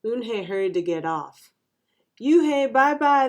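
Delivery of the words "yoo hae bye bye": put-on loud, high kids' call; teasing yell. A narrator's reading. Kids yell after the new girl, teasing. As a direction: yell a mocking goodbye. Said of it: "the kids yelled".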